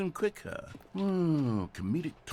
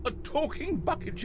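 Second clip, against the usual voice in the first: much higher, hammed-up comic delivery; 14 semitones higher than usual. Shown as a demonstration. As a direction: punch it up high for laughs. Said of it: "really going to nail the delivery".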